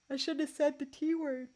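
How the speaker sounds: talking through a yawn; words stretched by a yawn. Real, not acted. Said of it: "Now I'm yawning".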